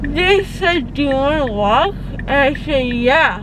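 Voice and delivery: talking around gauze; gauze in mouth. Real, not acted. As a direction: mouth packed with cotton.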